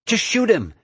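high-pitched urgent shout; impatient, high-voiced yell; shouted order. A narrator's reading. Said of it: "Ashley shouted".

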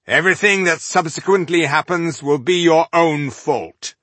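loud, high call across the field. A narrator's reading. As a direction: call it out to the whole army. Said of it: "the spokesman called out".

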